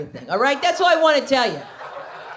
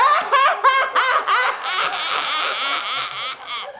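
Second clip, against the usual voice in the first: long, high stuttering mock wail-laugh; hammed-up helpless laughter; 6 semitones higher than usual. Husband undone by his comedian wife's joke, exaggerated high laughing wail. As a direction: let it pulse on and slowly fall.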